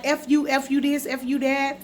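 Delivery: imitating someone going off; retold going off. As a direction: rattle off the insults like a retold tirade. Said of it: "She was just going off".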